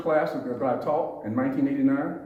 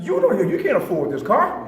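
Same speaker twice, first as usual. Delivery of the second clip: louder, higher disbelieving challenge; accusing officer; 5 semitones higher, 5 dB louder than usual. Shown as a demonstration. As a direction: voice the hidden insult openly. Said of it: "Subliminally, what did you hear me say?".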